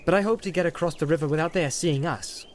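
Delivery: put-on young hobbit. Frodo voiced higher.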